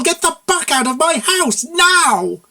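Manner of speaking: high, loud shrieking order; furious shout. A narrator's reading. Mare throwing the consultant out, high furious scream. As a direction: scream him out the door.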